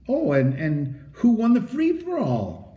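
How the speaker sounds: mock innocent. A narrator's reading. Doctor feigns ignorance.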